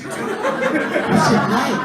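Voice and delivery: high, slow, drawn-out mockery; mocking. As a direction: stretch the question out mockingly. Mocking the question.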